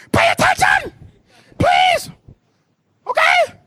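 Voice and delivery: very high squealed plea; mock exasperated shrieking; voice-straining screech. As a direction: screech each word, pausing between. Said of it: "I'm losing my voice".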